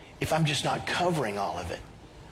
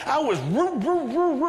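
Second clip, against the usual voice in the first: high, stuck stammer on the word; comically fail to get "wrong" out; Fonzie; 10 semitones higher than usual. Retold or imitated.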